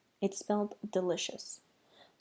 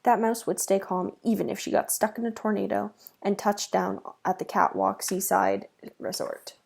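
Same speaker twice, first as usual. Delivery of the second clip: usual speaking voice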